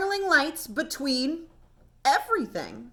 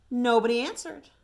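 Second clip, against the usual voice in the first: plain reading voice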